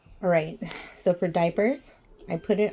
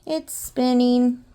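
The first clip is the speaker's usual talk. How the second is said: excited; 3 semitones higher, 11 dB louder, slower than usual. Real, not acted. Washer starts spinning, excited.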